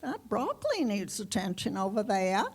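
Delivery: imitating grandma's r-dropping Maine accent; lightly voiced Maine farm grandma. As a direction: call out like a Maine grandma, r's dropped in "Ali" and "over there". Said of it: "she'd hold her crutch up and say".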